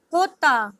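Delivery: jota said "HOH-tah"; Spanish letter J. Shown as a demonstration. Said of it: "J is pronounced as".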